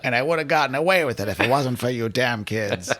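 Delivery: gruff cartoon villain voice; Scooby-Doo villain. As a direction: snarl it like an unmasked cartoon baddie. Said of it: "like a Scooby-Doo villain".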